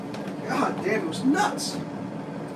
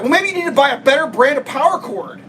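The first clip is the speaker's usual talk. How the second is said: mocking the clerk; 5 semitones higher than usual. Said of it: "she was like".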